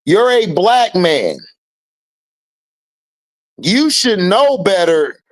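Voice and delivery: usual speaking voice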